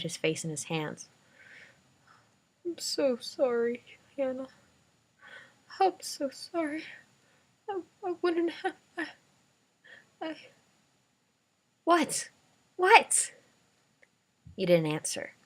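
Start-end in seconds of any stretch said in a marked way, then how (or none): 2.6-10.4 high, tearful apology; crying. A narrator's reading. Grandpa breaking down. 11.8-13.3 urgent. A narrator's reading. Girl demanding an answer.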